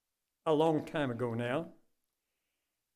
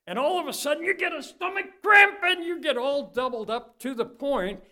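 higher, louder, urgent; dramatic alarm; 10 semitones higher, 5 dB louder than usual. Acted. Preacher dramatizing a swimmer's cramp, urgent and higher. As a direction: sell the sudden cramp.

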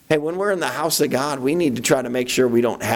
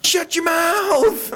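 high, slow, drawn-out scold; mock self-scolding; much slower than usual. Preacher telling himself to hush, high and slow. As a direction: stretch it out like a nagging aunt.

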